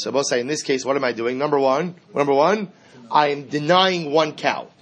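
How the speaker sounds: ordinary delivery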